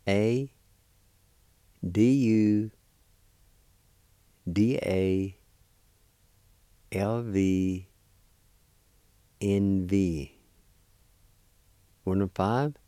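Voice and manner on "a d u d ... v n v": letters in measured groups, well spaced; letter-by-letter spelling. Shown as a demonstration. Teacher spelling a word for learners, letter by letter. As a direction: spell it out slowly with long pauses. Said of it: "It's spelled like this".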